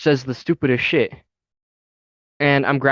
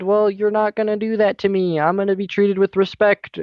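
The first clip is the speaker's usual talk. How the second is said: higher-pitched, insistent retelling; mildly indignant, raised-pitch quote; retold indignant protest; 7 semitones higher than usual. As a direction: re-enact standing up for yourself, pitch raised. Acting out standing up to boss.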